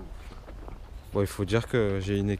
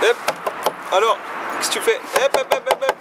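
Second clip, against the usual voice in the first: excited puppy encouragement; 13 semitones higher, 7 dB louder than usual. Real, not acted. Urging a puppy along.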